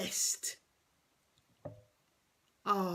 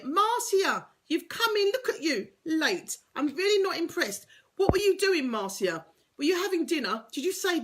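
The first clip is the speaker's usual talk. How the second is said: high, louder playful scolding; teasing mock reprimand; mock telling off; 7 semitones higher, 4 dB louder than usual. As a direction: pretend to be cross at her for being late.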